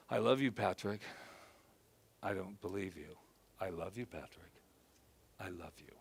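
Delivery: soft, hushed words to his own reflection; tender self-talk. Shown as a demonstration. A man in front of the mirror reassuring himself, soft and tender. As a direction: murmur it gently, like comforting a friend.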